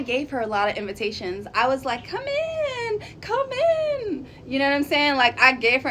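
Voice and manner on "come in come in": very high, sing-song "come in"; mock-sweet calling a guest in; sweet invitation. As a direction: coax someone inside with a sugary high voice. Inviting the woman inside, imitated.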